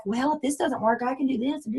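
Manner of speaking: soft, hedging doubtful mumble; hedging doubter. Retold or imitated. A recruit quietly doubting her own plan, soft and hedging. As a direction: murmur it like you don't believe in it. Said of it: "Stop talking like that".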